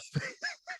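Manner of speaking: squeaky giggle; high squeak of laughter. Real, not acted. Host catching himself mid-joke, high squeaky giggle.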